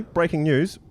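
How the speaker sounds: excited mock breaking-news call; sudden mock news flash. Real, not acted. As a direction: break in like a newsreader with a scoop. Sudden announcement of co-host news.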